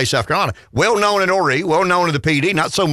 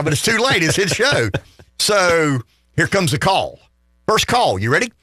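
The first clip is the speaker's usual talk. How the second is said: ordinary talk, nothing marked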